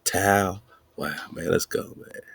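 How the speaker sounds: normal talking voice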